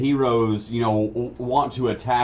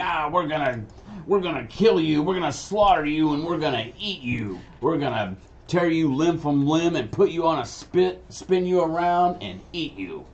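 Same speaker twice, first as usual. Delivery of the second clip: higher-pitched goblin taunting; goblin villain; 7 semitones higher than usual. Acted. Goblins threatening the players in combat, taunting goblin voice. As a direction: talk smack as a menacing goblin. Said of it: "you want the goblins telling you".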